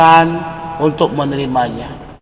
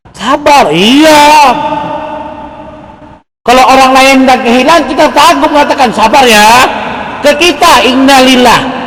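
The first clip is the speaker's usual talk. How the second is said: loud, high preacher's cry; preaching at full force; 8 semitones higher, 9 dB louder than usual. Acted. Preacher rousing the congregation with a loud high cry. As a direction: lift the voice and press the point.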